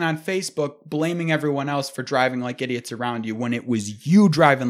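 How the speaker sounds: loud, heated tirade; worked-up scolding, starting high and loud; angry rant. Real, not acted. Ranting at reckless motorcyclists.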